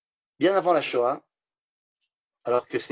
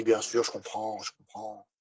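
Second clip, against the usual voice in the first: quiet reassurance; 5 semitones lower, 7 dB quieter than usual. Acted. Rabbi agrees calmly.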